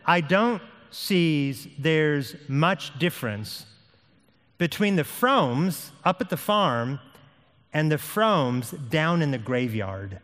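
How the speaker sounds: slow, deliberate reading with pauses; slow quoted verdict. Acted. Neighbor describing the Fromes to a listener, slow and deliberate. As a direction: weigh out each phrase slowly.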